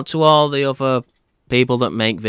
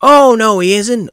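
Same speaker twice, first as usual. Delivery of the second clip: much higher, louder panto call-out; pantomime shout; 10 semitones higher, 8 dB louder than usual. Pretend. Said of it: "a pantomime baddie".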